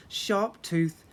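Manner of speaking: plain reading voice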